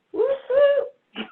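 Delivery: high-pitched woo-hoo; celebratory whoop. Real, not acted. Guest celebrating nearly ten years together, gleeful whoop. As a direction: let out a high, happy cheer.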